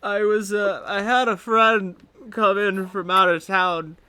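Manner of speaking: groggy hungover rambling. Acted. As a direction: drag through it like a bad hangover. Deb rambles about her hangover.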